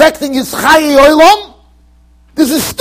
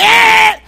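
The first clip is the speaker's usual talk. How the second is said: short high mock wail, louder; baby's scream; 9 semitones higher, 4 dB louder than usual. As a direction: imitate a bawling baby, briefly. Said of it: "I'm not going".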